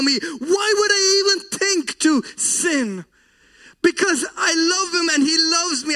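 rising, tearful sermon appeal; fervent preaching. Acted. Preacher urging listeners to love God, voice near tears. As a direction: plead with the congregation from the heart.

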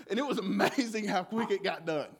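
normal talking voice

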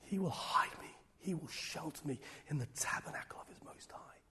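hushed unvoiced scripture; whispered psalm. Real, not acted. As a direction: breathe the verse like a secret. Reciting psalm intimately.

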